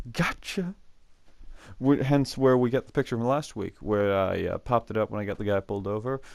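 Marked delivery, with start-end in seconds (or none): 0.1-0.7 high, sing-out teacher taunt; teasing teacher. Retold or imitated. Teacher taunting students while handing back failed tests, teasing. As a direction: mimic a teacher's gleeful gotcha, pitched way up. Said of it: "he's giving back failing grades".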